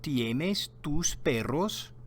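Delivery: ¿Tienes tus perros? said slowly, rising at the end; slow Spanish question. Shown as a demonstration. Maria asks Carlos about his dogs, slow rising question. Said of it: "she says".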